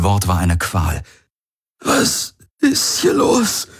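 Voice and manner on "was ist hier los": higher, slow, each word a struggle; a man in agony asking what is happening; pained, strained question. Acted. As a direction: squeeze it out through the pain. Garland in agony.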